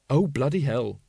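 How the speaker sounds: ordinary delivery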